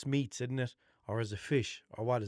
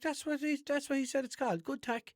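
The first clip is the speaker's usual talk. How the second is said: higher, lightly voiced sister explaining; a hint of the sister, pitched up; imitating sister. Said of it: "she was like".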